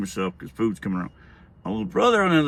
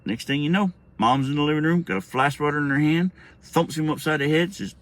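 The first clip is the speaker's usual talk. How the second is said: usual speaking voice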